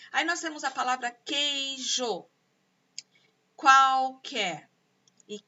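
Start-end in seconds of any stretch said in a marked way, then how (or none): 1.1-2.2 "queijo" stretched into QUEI and JO; drawn-out, divided "queijo"; syllable by syllable. Shown as a demonstration. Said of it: "ela tem três sons". 3.5-4.7 "qualquer" loud, split QUAL, QUER; loud, divided "qualquer"; syllable by syllable. Shown as a demonstration. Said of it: "ela tem três sons".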